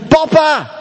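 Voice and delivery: high desperate call. Retold or imitated. Homeless daughter running after her father, calling high. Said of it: "ze rent naar hem toe".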